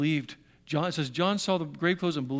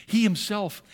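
He and himself both weighted, slightly raised; stress on He himself; 2 semitones higher than usual. Shown as a demonstration. Preacher stressing He himself to the congregation. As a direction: lean on He, then himself. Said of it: "there's a double emphasis".

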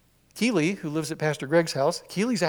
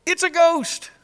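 higher, louder mock-terrified cry; 11 semitones higher, 7 dB louder, slower than usual. Preacher voicing terrified disciples seeing a ghost, scared cry. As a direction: cry it out like you've seen a ghost. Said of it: "they're terrified".

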